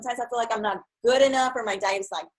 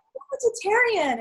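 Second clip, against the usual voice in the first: much higher, slow proud announcement; proud declaration; 12 semitones higher than usual. Retold or imitated. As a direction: say it high and slow, like big news. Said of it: "I'm like".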